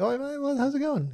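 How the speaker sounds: high, soft fake British accent. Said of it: "You're just using a fake accent".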